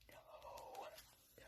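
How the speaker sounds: hushed, frantic warning; barely audible imitated plea; pleading whisper. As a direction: whisper it as a secret signal. Kid silently pleads from window.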